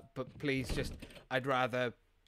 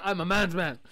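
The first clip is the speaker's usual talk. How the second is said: loud, higher joking insistence; laughing mock bluster; 6 semitones higher, 9 dB louder than usual. Streamer joking about crying to chat, laughing mock bluster. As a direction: burst out laughing, then protest loudly.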